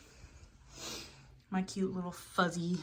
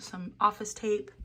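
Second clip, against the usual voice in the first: usual speaking voice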